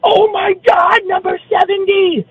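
high, shrieking mock fan squeal; excited fan shriek. Fan spots his jersey in the tunnel, shrieking high. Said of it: "and she goes".

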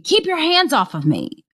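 understated imitation of someone screaming; retold scream. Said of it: "he then starts screaming".

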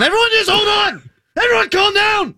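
high, exasperated shout; angry. Real, not acted. Host shouting down co-hosts talking over him, angry. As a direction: shout over everyone, pitch way up. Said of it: "Somebody's angry".

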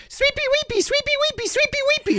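very high singsong pet calls; doggy voice. Retold or imitated. Owner coming home to her dog, very high singsong. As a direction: coo the dog's name way up high. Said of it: "I'm like".

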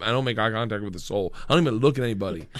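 ordinary talk

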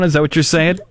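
ordinary talk

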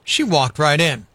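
lively read-aloud storytelling; story narration. A narrator's reading. Reading a story to kids.

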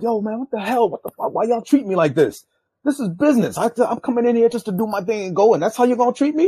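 high-pitched indignant rant; retold angry protest, pitch climbing; pissed off. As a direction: act out an aggrieved, heated complaint. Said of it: "now I'm pissed".

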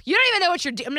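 imitating a child mouthing off to mom; mocking snippy kid. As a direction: snap it back like a bratty kid. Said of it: "hit me with".

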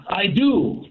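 normal talking voice

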